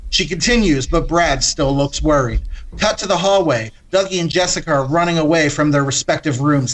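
usual speaking voice

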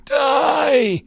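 very high, drawn-out shriek; shrill, crazed cry of rage; mad shrilling. A narrator's reading. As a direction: scream it madly and hold it. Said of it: "he shrilled madly".

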